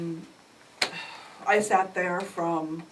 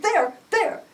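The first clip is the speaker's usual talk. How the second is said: high, loud, panicked; flustered pointing; 13 semitones higher, 7 dB louder than usual. Retold or imitated. Frightened mother to officer, panicked. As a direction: point and blurt it out.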